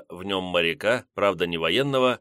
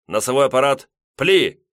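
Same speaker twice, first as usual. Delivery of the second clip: fire command; 5 semitones higher, 6 dB louder than usual. A narrator's reading. Firing order.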